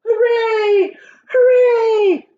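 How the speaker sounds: high, loud hooray; cheering. A narrator's reading. Travelers cheering at the sight of land. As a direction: shout it with joy.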